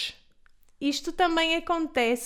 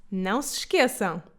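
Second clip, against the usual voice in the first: normal talking voice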